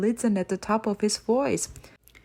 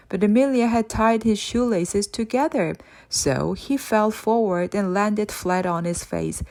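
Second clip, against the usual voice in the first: usual speaking voice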